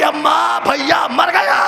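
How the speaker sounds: high shouted cry to the mother; a girl screaming to her mother in grief; retold scream. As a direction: wail it as the sister's scream. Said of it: "ایک چیخ ماری".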